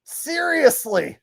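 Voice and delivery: high, loud, slow incredulous laugh-talk; really cracking up, stretch the words out high; laughing disbelief. Real, not acted. Host reacts to the absurd clip, high laughing disbelief.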